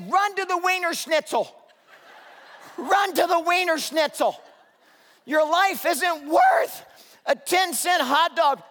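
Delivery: loud pleading yell, dragging out your life isn't worth; urgent shout. Retold or imitated. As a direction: pastor yelling at a family still eating hot dogs, mock-desperate shout. Urging family to flee storm.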